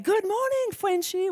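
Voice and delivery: cheery high Canadian greeting, imitating Alan; Canadian accent. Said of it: "with his Canadian accent".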